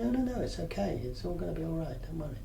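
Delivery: soft, hushed, fading to near silence; calm a frightened little monkey; soothing a pet. Retold or imitated. Therapist showing the client how to soothe the monkey, soft and tender. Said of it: "And would you say".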